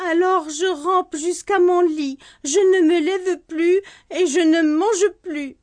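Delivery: put-on a sulky boy vowing to stay in bed; sulking child. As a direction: threaten it like a stubborn child. Child sulks, threatens hunger strike.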